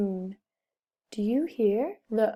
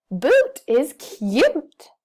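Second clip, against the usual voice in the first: excited; 3 semitones higher, 6 dB louder, much slower than usual. Shown as a demonstration. Teacher modelling excited reading for children. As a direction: stretch it out with delight. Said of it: "I'm going to sound excited when I read this sentence".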